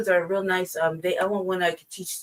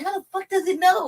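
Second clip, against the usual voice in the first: high-pitched retold disbelief; incredulous outburst; 11 semitones higher than usual. Speaker baffled that the bird knows, incredulous. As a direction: exclaim it high, baffled and indignant.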